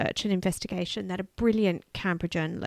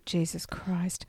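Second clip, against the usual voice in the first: shocked hushed whisper; 3 semitones lower, 8 dB quieter, slower than usual. Real, not acted. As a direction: breathe it out in disbelief. Shocked listener whispers reaction.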